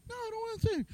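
put-on high, soft childish whine; whiny refusal. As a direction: refuse like a sulky kid.

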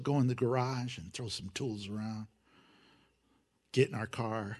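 ordinary delivery

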